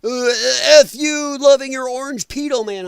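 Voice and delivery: a mild mock sob of outrage; mocking whiny heckler. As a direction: mimic the haters' sneering, half-crying voice. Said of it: "I usually get the".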